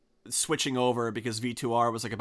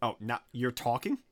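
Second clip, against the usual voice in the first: ordinary talk, nothing marked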